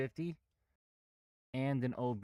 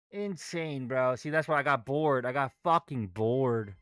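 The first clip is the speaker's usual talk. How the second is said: frustrated outburst; 2 semitones higher, 7 dB louder than usual. Real, not acted. Frustrated trader venting.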